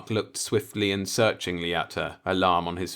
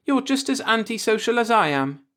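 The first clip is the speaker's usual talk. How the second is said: arch; 5 dB louder than usual. A narrator's reading. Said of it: "she replied archly".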